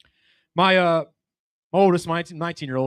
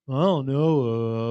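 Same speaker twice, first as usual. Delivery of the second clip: slow, spaced-out mumble; dazed stoner; 4 semitones lower, much slower than usual. Retold or imitated. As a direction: drag it out, half asleep. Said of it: "He goes".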